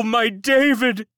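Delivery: slow, high loud wail on David. A narrator's reading. Servant wails the lost child's name, slow and loud. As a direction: draw out my David in grief. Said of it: "wailed a fourth".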